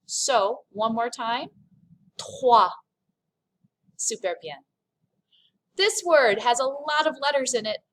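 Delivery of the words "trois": "Trois" louder, throaty R and "wa", no S; silent S. Shown as a demonstration. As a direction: model "Trois" firmly, ending on the vowel. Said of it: "I don't pronounce the S".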